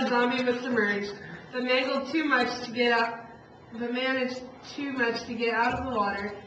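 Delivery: plain reading voice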